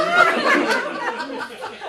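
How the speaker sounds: sudden high laugh burst. Real, not acted. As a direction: let out a bright high laugh. Reader laughs at the story.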